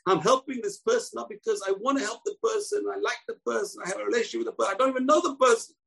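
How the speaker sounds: high, fast, fervent preaching; preaching at full force. Acted. Rabbi urging his students, preaching at full force. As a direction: press the point home to the class, rising. Rising preaching passage on mitzvot.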